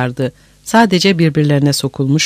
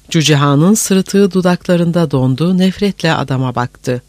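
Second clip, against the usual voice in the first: plain reading voice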